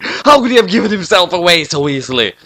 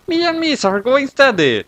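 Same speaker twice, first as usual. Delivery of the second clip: put-on high girl voice; 11 semitones higher than usual. Sayu tells Dark.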